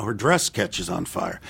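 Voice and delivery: normal talking voice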